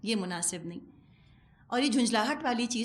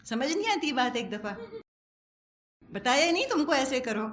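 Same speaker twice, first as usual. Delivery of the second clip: mock scolding; 4 semitones higher than usual. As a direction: play the exasperated cassette telling you off. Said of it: "cassette نے آپ کو ڈانٹنا شروع کر دیا".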